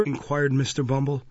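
ordinary delivery

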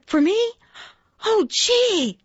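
high, thrilled squeal of thanks; delighted surprise. Acted. Reindeer receives a tiny flea, delighted surprise. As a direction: pitch leaps with childlike delight.

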